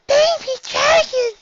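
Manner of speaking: very high, slow squeal of delight; shy pony overjoyed at a baby dragon; delighted cry. A narrator's reading. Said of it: "delighted cry".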